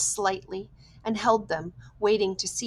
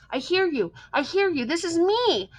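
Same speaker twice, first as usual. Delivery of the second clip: eager reply; 9 semitones higher, 4 dB louder than usual. A narrator's reading. Boy answers radio eagerly.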